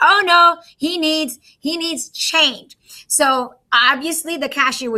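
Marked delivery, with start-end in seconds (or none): 0.1-2.8 mock alarm. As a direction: gasp it out like a teacher hamming up a mistake. Teacher reacts to wrong payment.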